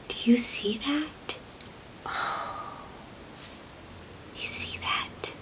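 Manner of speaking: gasped, whispered awe; hushed wonder. Acted. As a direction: whisper in amazement to a small child. Hushed wonder at the lit tree.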